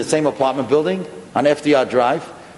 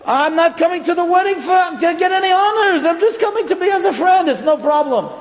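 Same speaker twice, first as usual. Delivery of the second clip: high, gentle reassuring voice; kind old rabbi; 14 semitones higher than usual. Retold or imitated. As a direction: put the embarrassed pair at ease. Rav Moshe reassures at door.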